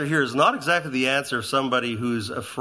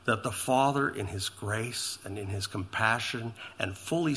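near-whispered praise of the cross; hushed worshipful preaching; 6 semitones lower, 9 dB quieter than usual. Acted. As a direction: let the voice sink into awe. Tender worshipful close.

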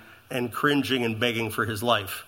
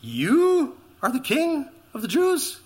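high, slow mocking question; scoffing disbelief; 11 semitones higher, slower than usual. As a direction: sneer at a beaten prisoner's claim. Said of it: "It doesn't line up with what he's been told".

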